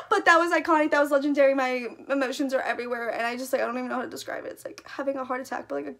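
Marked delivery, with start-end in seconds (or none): none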